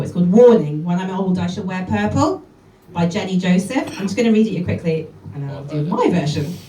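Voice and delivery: usual speaking voice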